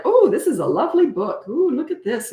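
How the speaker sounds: high, swooping show of wonder; exaggerated delight. Parent redirecting toddler with a book, exaggerated delight. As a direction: coo over it to lure a small child.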